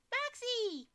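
high, loud shriek of the name; startled scream. Real, not acted. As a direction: scream it in fright. Startled by jump scare.

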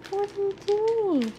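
ordinary delivery

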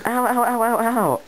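high yelping ows; pained yelps. Acted. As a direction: yelp each ow up high. Character in pain.

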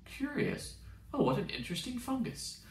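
a curious AI noticing the slug; robot curiosity. Acted. Ship AI eyeing Doomslug, lightly voiced curiosity.

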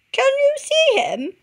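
chirpy searching call; bird voice. A narrator's reading.

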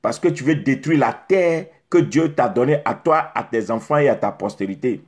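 ordinary delivery

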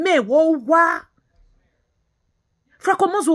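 ordinary talk

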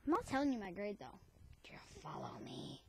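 hushed whisper. Real, not acted. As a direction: whisper it secretly. Whispered aside to viewers.

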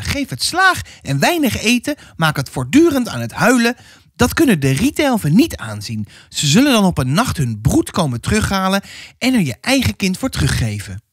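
high-pitched, insistent counsel; advising neighbour woman. Acted. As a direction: give harsh advice in a high woman's voice. Neighbour woman gives harsh advice. (dringend raadgevende buurvrouw, hoog)

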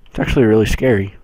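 ordinary talk

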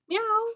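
high playful meow; cat meow. Shown as a demonstration. Teacher showing the children a cat's meow, high and playful. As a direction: show the kids how a cat meows. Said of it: "Can you meow like a cat?".

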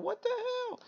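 sudden high-pitched what the hell; a squeaked outburst of disbelief; startled squeak. Real, not acted. Annoyed at the wrong card, a high squeak of disbelief.

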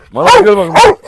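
loud, high call across the yard; calling the dog. Real, not acted. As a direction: call him over, bright and loud. Calling dog over at pool.

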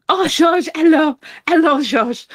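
put-on high-pitched feminine French accent; Nicole. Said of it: "the way she would say it".

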